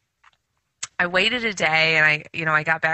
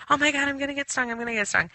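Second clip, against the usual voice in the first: fast, much higher panicked cry; frantic panic; 8 semitones higher, much faster than usual. Retold or imitated. Swatting the bee while panicking, fast high frantic cry. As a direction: rattle it off in a childlike terror. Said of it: "I was, like, out of my mind".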